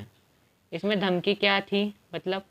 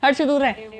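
repeated raised-voice call to keep away; insistent, higher-pitched shout; urgent warning; 4 semitones higher, 4 dB louder than usual. Real, not acted. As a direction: warn them off sharply. Calls out warning to brother.

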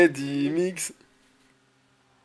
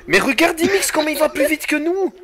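outraged shout; 13 semitones higher, 8 dB louder than usual. Real, not acted. Outraged at rival's speed.

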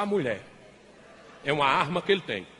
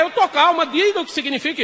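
much higher, loud angry insistence; shrieking wife; 9 semitones higher, 8 dB louder than usual. Acted. Wife furious at husband, high loud insistence. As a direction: yell that you're calm.

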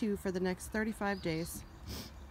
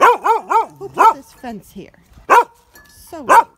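loud, slow, indignant dog voice; dog complains about the new fence, loud and indignant; voicing the dog; 4 semitones higher, 19 dB louder, slower than usual. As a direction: give the dog an outraged voice. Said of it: "He's like".

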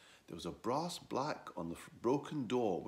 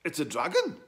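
surprised; 8 semitones higher, 9 dB louder than usual. Acted. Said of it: "said the donkey".

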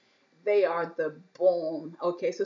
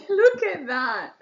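slow, delighted laugh; laughing in awe of food; 8 semitones higher, 5 dB louder, slower than usual. Real, not acted. Drooling over the food.

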